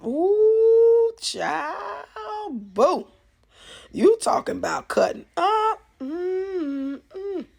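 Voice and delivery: high, loud gossiping squeal; excited gossip. Real, not acted. Vlogger gushing to viewers about Miss Loretta's live, high excited squeal. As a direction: squeal it like juicy gossip you can't hold in.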